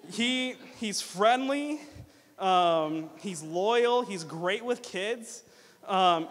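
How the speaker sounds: loud, playful list; playful roast. Real, not acted. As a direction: tease the groom playfully. Comparing groom to golden retriever.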